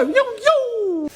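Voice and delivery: high whooping yo yo; high hooting call; yoo-yoo call. Real, not acted. Calling out yoo-yoo.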